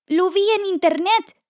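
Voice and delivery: high, insistent chick voice; high storybook chick; frightened little chick. Acted. Frightened chick answers the duck.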